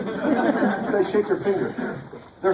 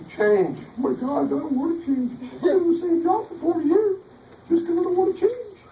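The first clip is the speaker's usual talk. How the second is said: high-pitched mock panic over change, much higher; 9 semitones higher than usual. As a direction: squeal it like a panicking creature of habit. Mocking panicked phlegmatic friend.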